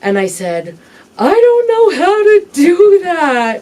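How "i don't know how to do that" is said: higher and louder, slow helpless plea; pleading confession. Retold or imitated. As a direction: cry out the admission slowly. Retelling reply to her mother.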